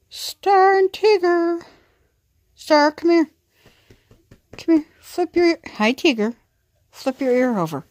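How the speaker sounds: high, loud pet-talk coo. Real, not acted. Cooing at the pets.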